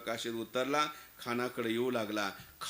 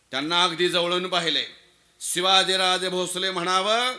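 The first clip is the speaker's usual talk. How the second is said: loud, raised, emphatic declamation; forceful testimony; 3 semitones higher, 8 dB louder than usual. Acted. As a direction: insist that you know the man by sight. Khan's son insists he knows Shivaji.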